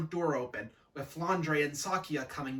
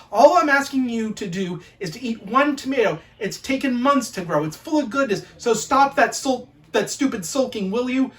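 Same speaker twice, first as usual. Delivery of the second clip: loud, raised telling-off; fed-up parent's rant; exasperated scolding; 3 semitones higher, 10 dB louder than usual. Acted. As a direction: lay into the sulking child, building in heat. Acted scolding of sulking child.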